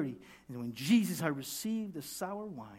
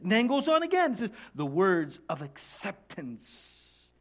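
ordinary talk, nothing marked

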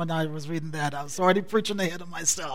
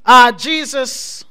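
loud, raised, driving sermon delivery; impassioned preaching; 8 semitones higher, 17 dB louder than usual. Real, not acted. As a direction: preach it out to the whole church, drawing out the refrain.

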